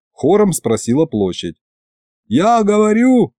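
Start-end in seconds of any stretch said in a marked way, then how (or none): 2.3-3.3 high, slowed bellow; bellowing over the crowd; yelling. A narrator's reading. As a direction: shout to be heard. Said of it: "снова заорал я".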